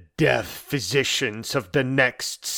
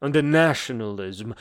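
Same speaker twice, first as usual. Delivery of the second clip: normal talking voice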